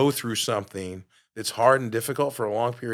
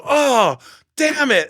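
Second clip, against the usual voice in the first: high, loud retold curse; a hammed-up, exasperated groan of a curse; mock frustrated outburst; 13 semitones higher, 8 dB louder, much slower than usual. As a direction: vent it like you're stuck in traffic.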